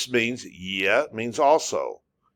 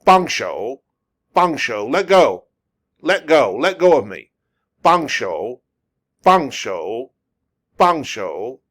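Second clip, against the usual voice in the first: firm, louder, acted protest; mock demand to let go; 4 dB louder than usual. Acting out being grabbed on the street in China, firm mock demand. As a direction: insist as if pulling free of a grabber. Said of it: "someone grabs you and holds on to you".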